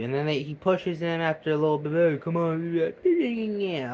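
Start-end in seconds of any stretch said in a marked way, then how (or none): 1.9-3.9 slow drawn-out nag; lightly voiced whiny nag; nagging. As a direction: stretch it out like a nagging pest. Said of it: "all that nagging".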